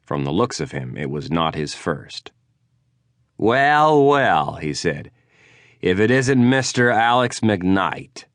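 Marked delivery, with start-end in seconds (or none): 3.4-8.3 lightly voiced big Yooper, sneering; mocking rival. Drunk rival greeting the detective at the bar, mock surprise.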